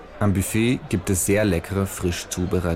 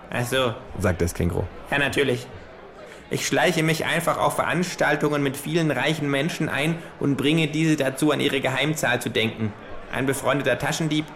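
kangaroo voice; 7 semitones higher than usual. Acted. Kangaroo fanning itself with play money, smug higher voice. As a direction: deliver it like a smug know-it-all.

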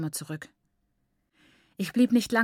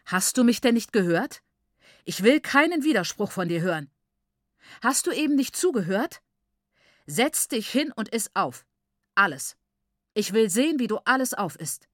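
loud, raised, commanding anger; angry scolding; 4 semitones higher, 6 dB louder than usual. A narrator's reading. Father orders his daughter to sit and eat everything, angry. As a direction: hammer out the orders angrily.

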